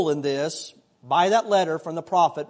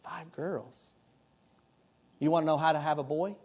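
lightly voiced stranger, hushed and lower at the end; a hint of a confiding stranger, quiet; nosy stranger; 3 semitones lower, 7 dB quieter than usual. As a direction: imitate a stranger softly sidling up with advice. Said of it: "someone stranger come to me and say".

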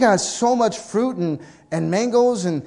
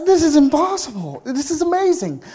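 much higher, louder, breathless wonder; excited mom; 10 semitones higher, 5 dB louder than usual. Acted. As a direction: gush over the miracle. Son voicing excited mom.

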